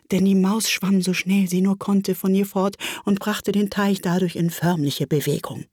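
ordinary delivery

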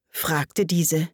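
ordinary delivery, nothing marked

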